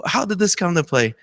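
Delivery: high delighted gushing; mock ecstatic. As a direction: gush like a thrilled believer. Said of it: "they're all ecstatic".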